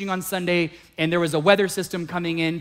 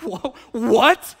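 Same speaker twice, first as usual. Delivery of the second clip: retold bewilderment; 4 semitones higher, 4 dB louder than usual. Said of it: "And I'm going".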